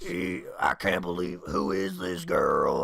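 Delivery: very low, slow leering drawl; mock Southern drawl. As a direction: ham up a smitten Southern creep. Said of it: "Like a happily smoking Southern dude".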